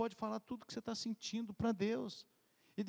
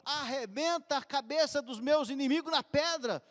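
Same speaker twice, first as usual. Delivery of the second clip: angry plea; 6 semitones higher, 6 dB louder than usual. Retold or imitated. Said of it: "que Davi fala assim".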